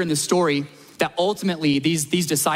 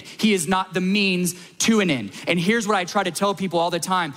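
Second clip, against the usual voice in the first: normal talking voice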